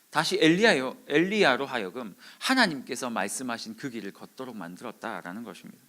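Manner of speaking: ordinary delivery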